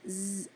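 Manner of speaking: "Z" as a held buzz, not the letter name; isolated Z sound. Shown as a demonstration. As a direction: buzz a long "Z" with no vowel. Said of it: "What about the Z sound?".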